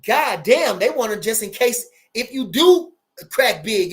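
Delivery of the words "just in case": ordinary talk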